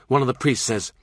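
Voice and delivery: plain reading voice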